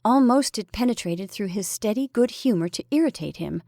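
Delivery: ordinary delivery, nothing marked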